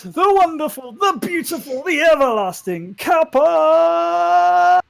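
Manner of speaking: high, loud, drawn-out announcer voice; big showman's welcome, building to a long held shout on the name; shouting master of ceremonies. Acted. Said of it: "and he s-, shouts".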